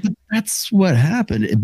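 high, slow innocent protest; mock innocence. The host claiming it just happened, high and innocent. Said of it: "you're like".

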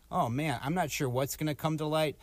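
normal talking voice

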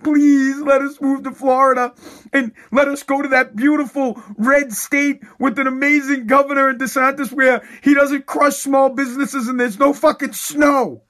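mock begging child. Daughter begging grandfather to move, high pleading voice. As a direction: beg like a kid to her grandpa. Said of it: "beg on her hands".

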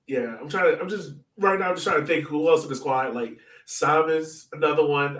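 ordinary delivery

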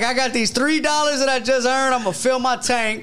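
mock old man rant.

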